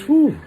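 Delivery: a sharp, high exhale of disbelief; high whistling whew. Pretend. Overwhelmed man staring at his new face, high whew.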